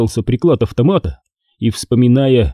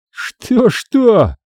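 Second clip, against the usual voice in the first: higher, sharply snapped back; mock barked retort; 10 semitones higher than usual. A narrator's reading. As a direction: throw the question back like a bark. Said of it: "рявкнул уже в свою очередь Сергей". (рявкнуть в ответ, выше голосом)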